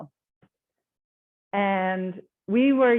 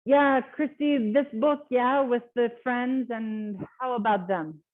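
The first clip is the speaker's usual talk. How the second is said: slow broken non-native English, pitch raised; lightly put-on learner English; imitating foreign English; 3 semitones higher, slower than usual. Shown as a demonstration. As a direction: mimic a learner fumbling for words. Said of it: "they say it in different English".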